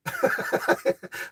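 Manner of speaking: high breathy giggle; a quick airy giggle, tickled; real laugh. Real, not acted. Laughing again at the joke.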